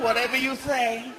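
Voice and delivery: ordinary talk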